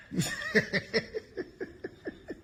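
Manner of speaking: long wheezy laugh; mocking laugh. Real, not acted. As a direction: laugh voicelessly at his claim. Laughing at the CEO claim.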